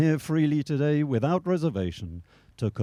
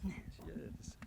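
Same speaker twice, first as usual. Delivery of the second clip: whispered; 5 semitones higher, 21 dB quieter than usual. Real, not acted. Officiant approving quietly.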